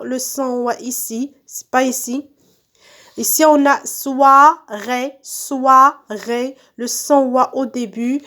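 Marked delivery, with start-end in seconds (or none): 3.9-6.6 soirée slowly split into SOI and RÉE; syllable by syllable. Shown as a demonstration. Teacher cutting words into syllables, syllable by syllable. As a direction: sound out soirée in two separate beats. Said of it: "je découpe les mots en syllabes".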